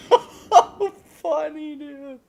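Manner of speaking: barely getting it out between laughs; laughing hard. Real, not acted. Helpless laughter at the story.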